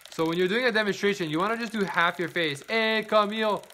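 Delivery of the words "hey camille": raised, brighter greeting call. Real, not acted. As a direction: call out to a viewer on the stream. Greeting a viewer by calling out.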